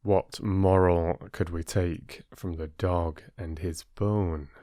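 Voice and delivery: ordinary delivery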